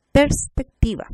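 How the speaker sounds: Perspectiva with a loud PERS set apart; first syllable separated. Shown as a demonstration. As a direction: say PERS, brief pause, then PECTIVA. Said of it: "Consonante, vocal, consonante".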